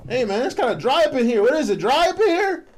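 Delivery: hammed-up call for a drink; mock complaint. Joking complaint, voice raised.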